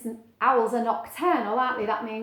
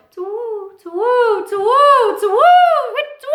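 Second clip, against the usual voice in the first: hooting louder and louder; 12 semitones higher, 15 dB louder than usual. Acted. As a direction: hoot closer and closer. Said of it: "the hoot got louder and louder".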